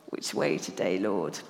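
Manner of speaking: low, soft whispered prayer; whispered prayer at the signpost; hushed whisper. Shown as a demonstration. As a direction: breathe it to God privately. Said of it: "hence the signpost".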